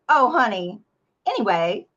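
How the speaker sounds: loud, sugary dismissive niceness; Pacific Northwest nice. Retold or imitated. Husband doing polite dismissal, loud sugary brush-off. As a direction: brush it off sweetly, loudly. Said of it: "Pacific Northwest nice".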